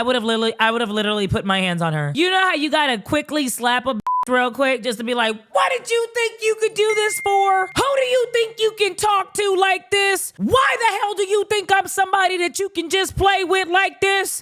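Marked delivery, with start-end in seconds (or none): 5.5-14.4 high, raised-voice mock tirade; hammed-up indignant scolding; outraged confrontation. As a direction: play at yelling someone down, pitched way up. Acts out slapping and confronting her.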